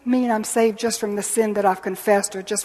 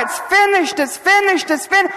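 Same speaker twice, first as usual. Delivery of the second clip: high, loud ringing calls; repeated triumphant cry; 12 semitones higher, 6 dB louder than usual. Retold or imitated. People of every age echo the cry, loudly. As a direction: cry it out over and over. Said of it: "have heard that cry".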